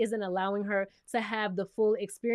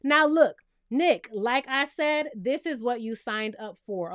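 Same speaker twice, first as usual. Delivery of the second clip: ordinary talk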